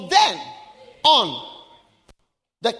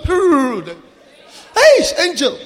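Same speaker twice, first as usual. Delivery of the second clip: calling out; 5 dB louder, much slower than usual. Acted. Calling out to the angel.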